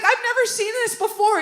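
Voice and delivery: high, loud stunned exclamation; a high, loud man's disbelief; shocked. Retold or imitated. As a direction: blurt it out, still rattled. Exterminator running down shocked.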